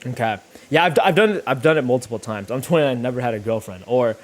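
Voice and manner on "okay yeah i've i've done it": usual speaking voice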